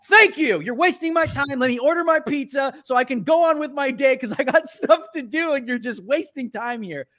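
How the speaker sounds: high, loud retold impatience; exasperated outburst. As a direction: let the pent-up irritation burst out. Acted exasperated retort in quarrel.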